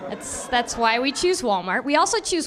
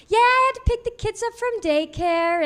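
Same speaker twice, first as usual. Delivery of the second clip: high, bright mom chatter; sister's chatty voice; 9 semitones higher than usual. Retold or imitated. Sister chatting to comedian, lightly voiced. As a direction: imitate the sister chatting casually. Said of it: "She'd start out being like".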